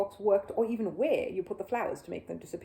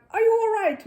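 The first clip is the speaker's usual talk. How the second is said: much higher, louder concerned call; 13 semitones higher, 10 dB louder than usual. A narrator's reading. Mr Gruber knocking on the box, calling in worried.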